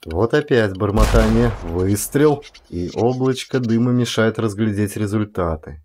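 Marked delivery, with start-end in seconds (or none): none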